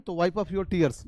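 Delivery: tears said like टीयर्स, very slowly; tears as teers. Shown as a demonstration. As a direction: drag it out so tears clearly rhymes with here. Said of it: "टीयर्स मत पढ़ देना". (tears को टीयर्स बोलिए, बहुत धीरे)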